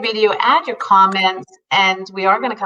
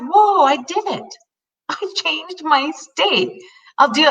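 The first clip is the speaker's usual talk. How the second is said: high, slow, thrilled whoa; amazed delight; 10 semitones higher, slower than usual. Retold or imitated. Telling the host how she changed her mood, slow amazed delight. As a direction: savor the win, wide-eyed. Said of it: "I was like".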